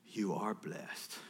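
low, soft, slow assurance; father's affirmation. Retold or imitated. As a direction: affirm it quietly and slowly. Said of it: "you know what I say?".

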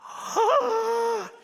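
very high, loud gasp of joy; ecstatic gasp. Retold or imitated. Dad greeting his twins after school, ecstatic gasp. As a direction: gasp with huge delight. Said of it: "I go crazy".

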